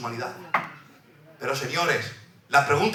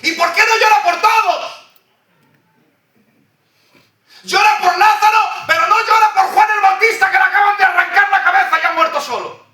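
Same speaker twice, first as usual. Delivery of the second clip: much higher and louder, crying out; impassioned preaching shout; 4 dB louder than usual. Acted. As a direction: hammer the question at the crowd.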